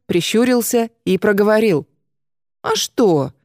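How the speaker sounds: ordinary delivery, nothing marked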